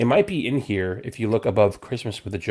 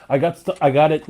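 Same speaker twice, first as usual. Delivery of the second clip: ordinary delivery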